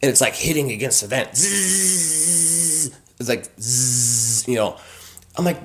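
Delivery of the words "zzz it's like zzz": soft, slow imitated buzz; buzzing bee noise. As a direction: hum like a bee stuck in a vent.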